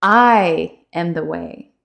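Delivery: I leaned on, slow; "I" stressed, slow and falling. Shown as a demonstration. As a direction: hit the word I first. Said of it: "emphasize specific words".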